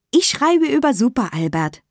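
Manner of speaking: put-on high child's voice calling out; eager schoolkid piping up; excited call. A narrator's reading. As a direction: call out your choice brightly, pitch up. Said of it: "rief Tamtam".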